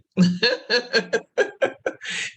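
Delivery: a big high burst of laughter; hearty laugh. Real, not acted. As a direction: laugh out loud at the memory. Laughing at her own story.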